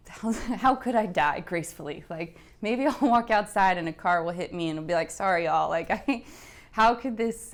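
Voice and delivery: a dark joke laughed through; laughing. Real, not acted. Recalling her dark thoughts on the couch, rueful laughter. Darkly joking about dying gracefully.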